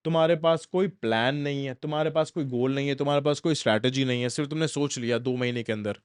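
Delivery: ordinary delivery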